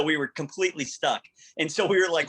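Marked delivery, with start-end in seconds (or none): none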